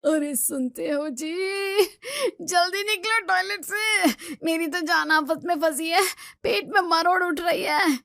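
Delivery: ऊँची, खिंची हुई मिन्नत; दर्द में पति को जल्दी बुलाना; पेट दर्द से बेहाल. Acted. Wife with stomach cramps pleading. (Toilet के बाहर पति से गिड़गिड़ाती पत्नी, दर्द में ऊँची आवाज़)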